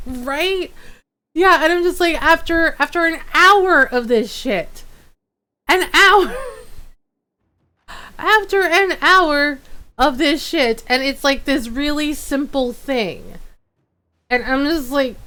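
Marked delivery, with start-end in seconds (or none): 2.2-10.8 higher-pitched, emphatic exasperation; exasperated venting. Retold or imitated. Streamer venting about an hour of troubleshooting, exasperated. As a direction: hammer it out in pitched-up disbelief.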